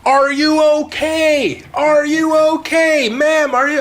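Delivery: high, slow worried yell; calling out high and drawn out to a crash victim; retold yelling. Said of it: "all I hear is him yelling".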